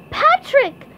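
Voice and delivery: high, loud calling out; SpongeBob voice. A narrator's reading. SpongeBob spotting Patrick walking by, calling out high and loud. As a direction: hail a friend in a cartoon squeal.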